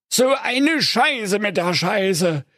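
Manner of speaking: hohe, gedehnte Zwergenstimme; Zwerg Gernot. A narrator's reading. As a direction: jammert wie ein Zwerg. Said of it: "Der Gernot rief". (hohe jammernde Zwergenstimme)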